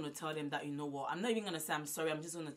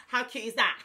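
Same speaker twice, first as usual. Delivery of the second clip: giddy laughing; 4 semitones higher, 8 dB louder than usual. Real, not acted. Giddy over Mother's Day gifts.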